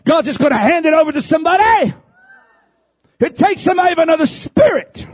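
high, loud, impassioned preacher's cry; full-force preaching. Acted. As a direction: preach it out to the congregation with fire.

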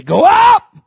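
high, loud go up; leading a shout. Acted. Preacher to the congregation, shouting go up. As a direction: lead the room in the call. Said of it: "Everyone look at your neighbor and say".

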